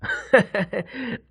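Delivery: a short amused chuckle; soft chuckle; light laugh. A narrator's reading. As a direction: laugh off a silly dream. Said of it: "he laughed".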